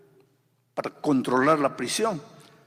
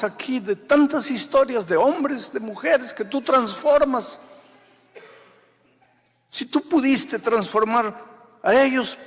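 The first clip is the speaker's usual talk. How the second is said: higher and louder, rising to a climax; preaching build-up; 9 semitones higher, 7 dB louder than usual. Acted. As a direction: drive it up with fervor. (predicación creciente, más aguda y fuerte)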